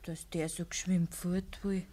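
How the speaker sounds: hushed, breathy whisper; whispered aside. Acted. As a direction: say it under your breath. Daughter murmurs aside to father.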